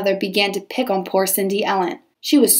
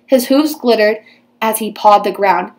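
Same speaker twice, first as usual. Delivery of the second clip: plain reading voice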